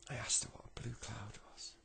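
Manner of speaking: put-on kid's question breathed in a whisper. Kid whispering the question to his granddad. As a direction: lean in and whisper it.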